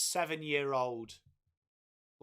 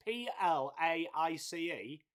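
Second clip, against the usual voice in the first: spelled out. Shown as a demonstration.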